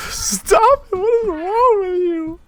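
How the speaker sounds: very high, wailing falsetto; hammed-up tearful woman's protest; distressed mother. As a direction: cry out at your husband, loud and shrieky. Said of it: "you hear the argument".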